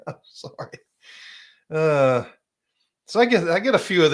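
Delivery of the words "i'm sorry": laughing. Real, not acted. Laughing apology after comment.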